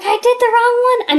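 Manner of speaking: sudden horrified realization, loud and high; alarmed. Retold or imitated. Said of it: "I'm like".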